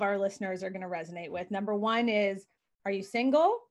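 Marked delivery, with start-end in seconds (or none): none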